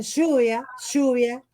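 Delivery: "lluvia" said "shuvia", with a strong sh; Argentine SH sound. Shown as a demonstration. Actress imitates Argentine pronunciation for interviewer, SH sound for LL. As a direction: hit the LL of "lluvia" as a loud sh. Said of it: "la Y que hacen lluvia".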